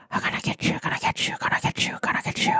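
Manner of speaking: eerie unvoiced chant; whispering haunted forest. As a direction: whisper it like menacing trees. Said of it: "The whispering haunted forest".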